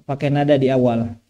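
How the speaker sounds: ordinary delivery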